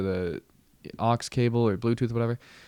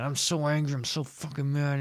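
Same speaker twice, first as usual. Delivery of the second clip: mock angry dad; 5 semitones higher than usual. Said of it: "he was, like, yelling and he was mad".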